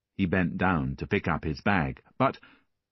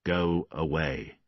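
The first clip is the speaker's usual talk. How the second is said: gruff man; slower than usual. A narrator's reading. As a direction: send the child off slowly and coldly. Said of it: "said Valjean".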